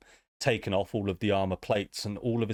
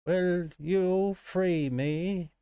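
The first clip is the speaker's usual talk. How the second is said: slow, high plea; pleading robot; 8 semitones higher, much slower than usual. Acted. Trapped warforged begs the player to free him, slow and high. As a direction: beg slowly to be freed.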